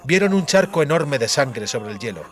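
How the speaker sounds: plain reading voice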